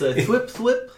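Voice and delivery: high laughing thwip thwip; playful thwip noises. Pretend. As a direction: chirp the web sound, giggling. Russian Spider-Man bit.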